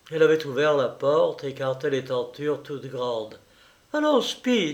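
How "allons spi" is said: much higher, sweet coaxing call. A narrator's reading. Juliette calling her little dog, high coaxing voice. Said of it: "dit-elle d'une voix calmine".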